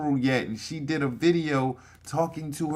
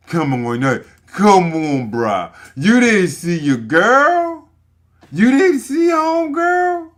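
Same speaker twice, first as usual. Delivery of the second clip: higher and much louder, building disbelief; incredulous shout; 10 semitones higher, 8 dB louder than usual. Real, not acted. Reacting to the mother's bathroom video, incredulous shouting. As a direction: yell it at the screen in disbelief.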